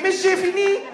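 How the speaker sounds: high, eager pupil's call; hopeful pupil calling out. Acted. Hopefully calls teacher, mimicking classmate.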